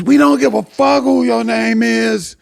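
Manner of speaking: high, loud, drawn-out interruption; heckling yell. Retold or imitated. Heckler interrupting the comic's introduction, high loud yell. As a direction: holler over the comic's introduction.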